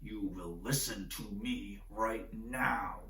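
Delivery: pinched, tight and slow, held back anger; a father squeezing the words through tension; strained low voice. Shown as a demonstration. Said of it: "the voice gets strained like this".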